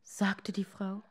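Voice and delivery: usual speaking voice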